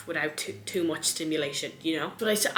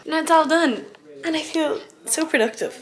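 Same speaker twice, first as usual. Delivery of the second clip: excited; 10 semitones higher, 8 dB louder than usual. Real, not acted. Proud of finishing her chores.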